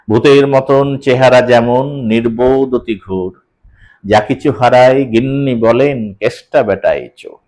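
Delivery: rhythmic reading, stopping between feet; paused recitation. Shown as a demonstration. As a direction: recite slowly, pausing at each beat. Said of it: "থামছি আমি, একটু থামতেই হচ্ছে আমাকে".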